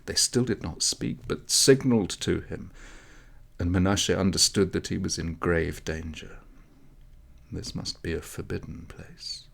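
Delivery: usual speaking voice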